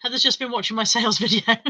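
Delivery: ordinary talk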